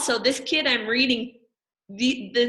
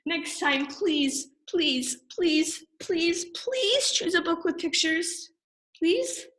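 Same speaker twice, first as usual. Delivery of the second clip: mock begging; 8 semitones higher, slower than usual. Reader begging for picture books.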